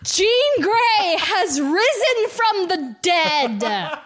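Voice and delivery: high, slow, stretched-out proclamation; grand dramatic reveal. Real, not acted. Host revealing Jean's return to co-host, dramatic proclamation. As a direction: proclaim it word by word like a miracle. Announcing the big comic reveal.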